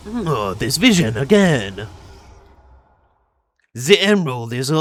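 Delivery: mangled French-Spanish accent, mocking the voice acting. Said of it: "can you make Shadow French and also Spanish".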